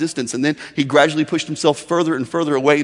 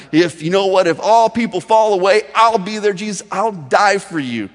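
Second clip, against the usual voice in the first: bragging Peter; 5 semitones higher, 5 dB louder than usual. Retold or imitated. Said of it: "from a bragging guy".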